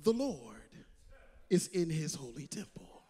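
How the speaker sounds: low, hushed voice of God; voice of the Lord. Acted. God speaking to the preacher, low and hushed. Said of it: "he comes to me and he says".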